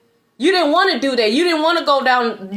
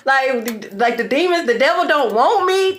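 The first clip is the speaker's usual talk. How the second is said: mock-outraged at being denied hell; incredulous outburst; 3 semitones higher than usual. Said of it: "Imagine me like".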